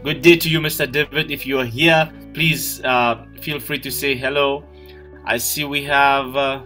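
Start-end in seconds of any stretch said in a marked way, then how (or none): none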